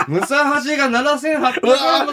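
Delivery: high, loud mock yell at a junior; loud call-out. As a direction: imitate the boss calling out across the office, half laughing. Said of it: "大きい声で".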